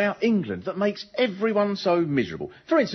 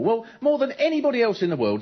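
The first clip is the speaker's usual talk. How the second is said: normal talking voice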